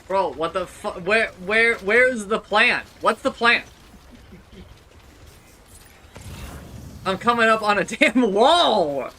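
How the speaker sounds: loud, high panicked shouting; frustrated yelling. Real, not acted. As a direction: yell at the game in frustration.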